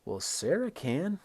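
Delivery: "Sarah" high, loud, slow rise; rising "Well, Sarah can". Shown as a demonstration.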